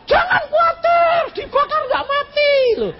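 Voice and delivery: high, loud mocking comfort; mock reassurance. Preacher mocks believers living in fantasy: high shouted mock comfort. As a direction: ham up a naive promise.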